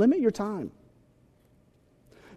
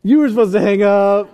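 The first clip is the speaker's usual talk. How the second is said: mock indignant lover; 2 semitones higher, 6 dB louder than usual. Mock lovers' phone quarrel.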